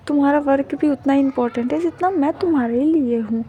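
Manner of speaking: tender, cooing reassurance; sweet comforting partner. Acted. Comforting sad partner, younger sweet voice.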